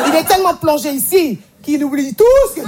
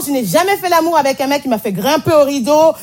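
ordinary delivery

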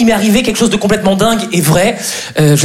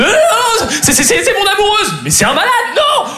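panicked; 13 semitones higher than usual. Retold or imitated. Panicked denial to police.

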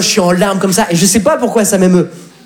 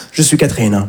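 lightly voiced woman; 8 semitones lower than usual. Phone identity as woman.

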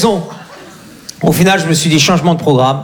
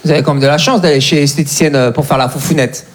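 mock solemn; 8 semitones lower than usual. Mock-solemn address to ladies.

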